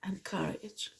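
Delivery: soft intimate whisper of advice. Real, not acted. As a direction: lean in and whisper it to them.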